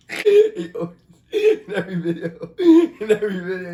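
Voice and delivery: laughing hard through words; can't stop laughing while talking. Real, not acted.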